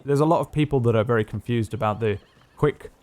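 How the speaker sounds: normal talking voice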